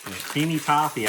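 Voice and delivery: a hammed-up English accent on the candy name; mock English accent. Shown as a demonstration. Tasting Irish candy, in a bad English accent. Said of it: "terrible English accent".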